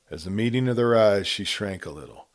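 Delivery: ordinary delivery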